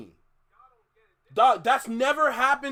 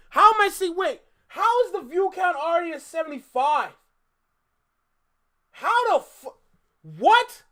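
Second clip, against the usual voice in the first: high, loud, half-laughing amazement; stunned disbelief; 7 semitones higher, 6 dB louder than usual. Real, not acted. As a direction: blurt out shocked disbelief. Disbelief at view count.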